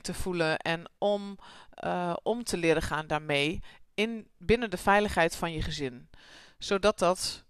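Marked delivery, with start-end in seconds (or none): none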